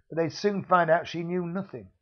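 plain reading voice

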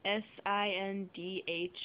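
ordinary talk

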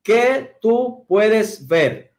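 "¿Qué tú puedes ver?" said very slowly, each word apart; patient teacher spacing out the words. Shown as a demonstration. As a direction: spell out the four words slowly. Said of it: "Cuatro, cuatro palabras".

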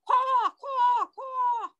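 high-pitched, pretend-scary crow caws; repeated piercing crow cries; frightful crow cawing. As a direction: caw like a crow scaring someone off. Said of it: "in a frightening, frightful voice".

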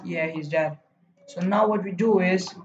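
ordinary delivery

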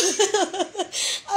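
high, overwhelmed laugh; real laugh. Real, not acted. Streamer overwhelmed by singa gift, high laughing. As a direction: burst into a high-pitched laugh.

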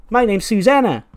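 high, loud sing-out of her name; odd girl's introduction. Acted. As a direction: announce yourself like an eager child. Odd girl introduces herself, acted.

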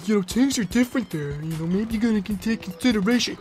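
quiet, nervous peacekeeping; calm, soothing. Real, not acted. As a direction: talk him down gently. Trying to calm angry Paul.

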